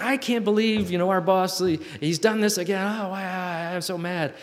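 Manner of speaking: high-pitched fake outrage fading to a grumble; mock indignant coworker. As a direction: play the upset coworker venting. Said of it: "they're like".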